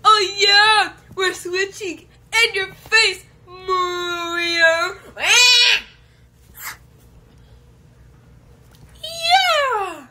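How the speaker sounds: loud, high triumphant shout; excited cheering. Acted. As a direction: gloat at the rival. Celebrates switching to the bed.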